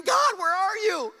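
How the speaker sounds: loud, high, drawn-out cry; demanding complaint. Acted. Churchgoer doubts God an hour later, loud mock complaint. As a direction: wail it up to God in mock crisis. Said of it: "we're, like, asking".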